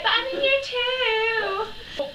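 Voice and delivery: put-on high, slow, sing-song child; whiny little girl. Little Lucy protesting to the teacher, sing-song whine. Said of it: "she was like".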